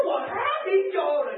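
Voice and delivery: high, fast, shouted protest; mock-offended high burst; indignant retort. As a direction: snap back that you're not proud at all. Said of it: "我说：".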